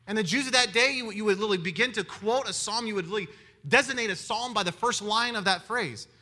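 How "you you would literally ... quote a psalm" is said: ordinary delivery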